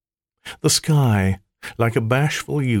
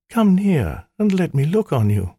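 high, coaxing temptress voice; seductive maiden; 9 semitones higher than usual. Acted. Spirit in maiden form teasing the old magician, coaxing. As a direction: lure him closer, sweetly.

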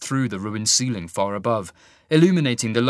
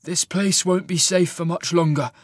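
higher-pitched rasp; rasped warning; 6 semitones higher than usual. A narrator's reading. Cole warns Sasha the place is unsafe, rasped. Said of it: "he rasped".

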